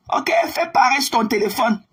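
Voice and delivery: usual speaking voice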